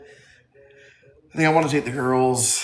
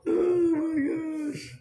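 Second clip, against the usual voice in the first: high, slow, stretched oh my gosh; drawn out high in helpless laughter; squealing laugh; much slower than usual. Real, not acted. Overcome with laughter at chat.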